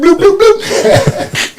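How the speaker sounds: high squeaky bloop bloop sound effect; playful mock sound effect; silly bloop noises. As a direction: make goofy bubble noises, rising high. Repeats sound effect laughing.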